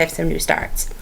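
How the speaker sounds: whispered aside; conspiratorial whisper. Acted. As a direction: drop to a secret whisper. Whispered aside about new starts.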